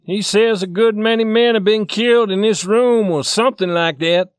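loud, high, exasperated explanation; angry. Acted. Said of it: "gathering them together angrily".

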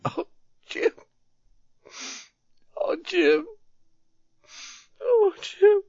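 very high, anguished wail; crying sadly. A narrator's reading. Youth grieving for his wounded friend, crying sadly. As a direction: wail the name, building in pitch and force. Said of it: "The youth still cried sadly".

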